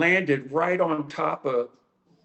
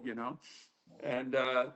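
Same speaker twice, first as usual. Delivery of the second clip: ordinary talk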